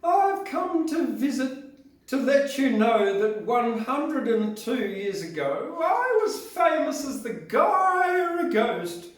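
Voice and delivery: drawn-out eerie high ghost; Guyra ghost. Acted. Poet reading as the Guyra ghost in a high slow voice. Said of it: "should be done in a female voice, but it's not gonna be".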